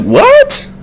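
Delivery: extremely high falsetto question; acted bafflement. Vlogger repeating his stunned reaction, baffled. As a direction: squeak it upward, incredulous.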